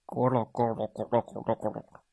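breathy rumbling ku-lu sounds; bear tummy rumble. A narrator's reading. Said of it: "咕噜咕噜的声音".

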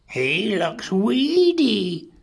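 high, slow complaint; grumbling mother dinosaur. A narrator's reading. Horrified mother judging her odd baby, high slow grumble. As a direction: draw it out, high and disapproving. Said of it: "And she grumbled".

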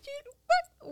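very high squeaky doting voice, laughing; high laughing mock scold to a dog; puppy voice. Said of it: "like a little puppy or a kitten".

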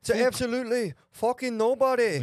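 high, loud Irish brogue; Conor McGregor impression. Friends quote McGregor in a high, loud Irish brogue. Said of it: "McGregor's like".

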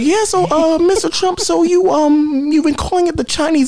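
high, hesitant woman's voice; reporter lady voice. Retold or imitated. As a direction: raise the pitch and stammer politely. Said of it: "the lady was like".

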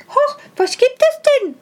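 high, surprised little wife voice; startled wife. A narrator's reading. The wife, roused by her husband, asks high and startled. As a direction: jump up in alarm as the hedgehog's wife. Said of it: "sagte die Frau".

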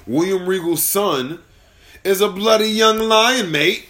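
a rough William Regal voice; William Regal impression. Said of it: "Your William Regal impression is horrible".

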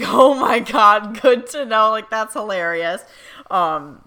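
embarrassed laugh. Real, not acted. Embarrassed reaction to spirits' privacy.

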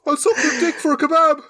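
hammed-up beggar offer; mock homeless man. Voicing homeless guy.

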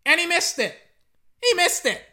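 loud, high outburst of disbelief; exasperated shout. Real, not acted. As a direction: shout out the missed kick. Reacts to missed field goal.

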